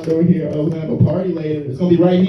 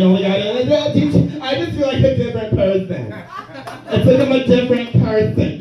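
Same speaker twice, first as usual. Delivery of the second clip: higher, gushing vegan parody; mock vegan gushing; 8 semitones higher than usual. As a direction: rave like a new convert. Mocking vegans.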